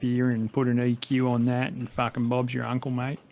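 thick Australian bogan tutorial voice. Acted. Musician mocking his old YouTube tutorials, thick bogan accent. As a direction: ham up a broad bogan Aussie accent. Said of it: "I sound like a fucking bogan".

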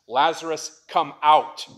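a commanding shout into the grave; loud call. A narrator's reading. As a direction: summon him from the tomb with full voice. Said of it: "called out in a loud voice".

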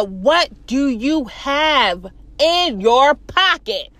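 very slow, word by word; deliberate questioning. Retold or imitated. Guard questions man about his pocket, deliberate. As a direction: spell it out slowly as an acted guard.

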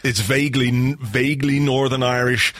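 normal talking voice

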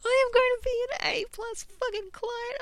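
high, loud hyped-up boast; excited. Real, not acted. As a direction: pump yourself up, voice soaring. Excited self-hype.

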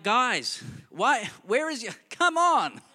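mildly imitating a sleepy, annoyed man; grumpy Jesus. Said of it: "he seems understandably grumpy about it".

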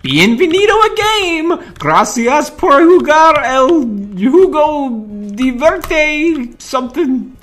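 slow, high mock Spanish. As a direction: fake your way through Spanish. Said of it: "I don't speak that language".

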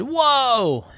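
high, drawn-out startled cry. Acted. Llama waking to a lightning bolt, startled. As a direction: cry out in amazement.

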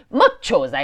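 exclaimed scolding. A narrator's reading. Mother bitten by her daughter, exclaiming crossly. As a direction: exclaim it at a naughty child. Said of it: "esclamò la madre".

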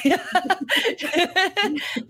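bright high laugh. Real, not acted. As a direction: burst into a laugh. Host laughs at intro.

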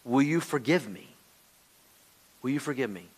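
grave confession softening to a plea; acted repentance. Repentance admits sin and asks forgiveness, grave and softening. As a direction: confess in short phrases with long pauses, ending hushed. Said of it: "repentance says".

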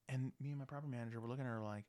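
normal talking voice

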